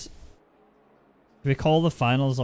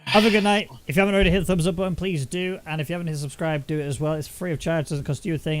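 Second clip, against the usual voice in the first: outro announcer; 3 semitones higher than usual. Acted. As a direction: sell the subscribe plea brightly. Outro call to action.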